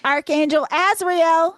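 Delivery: slow, loud, high announcement of the name; drawn-out, excited reveal; dramatic reveal. Real, not acted. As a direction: unveil the punchline with a flourish.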